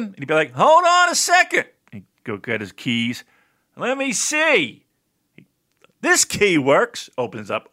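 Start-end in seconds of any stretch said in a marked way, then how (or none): 0.5-1.7 high, put-on teacher voice, unhurried; Mister Tomarcio imitation. Chemistry teacher answering students about his candy closet, in a high put-on voice. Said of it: "I'm doing my Mister Tomarcio imitation". 3.8-7.1 high, slow teacher voice drawing it out; Mister Tomarcio imitation. Chemistry teacher unlocking his snack closet, in a high, slow voice. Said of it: "I'm doing my Mister Tomarcio imitation".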